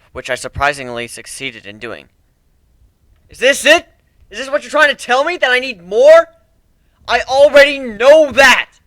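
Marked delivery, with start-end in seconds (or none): 3.3-8.8 high, loud frantic arguing; desperate outburst. Acted. Griff argues with his subconscious, frantic outburst. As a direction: shout down your own mind.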